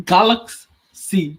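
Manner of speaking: GALAXY drawn out slowly, broken before the final "see"; imitated pronunciation. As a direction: mimic a halting, stretched "Galaxy". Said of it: "learned how to say galaxy".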